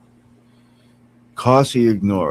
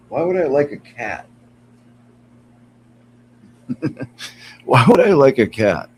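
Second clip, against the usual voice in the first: usual speaking voice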